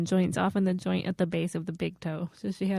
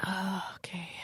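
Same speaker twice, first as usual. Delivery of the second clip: whispered, winded after laughing; breathless recovery; 11 dB quieter than usual. Real, not acted. Listener composing herself after a laughing fit, whispered. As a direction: let it out as a voiceless sigh.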